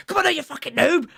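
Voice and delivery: high, loud imitation of gaming rage; gamer shouting. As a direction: re-enact yelling at a video game. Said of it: "or whatever, shouting".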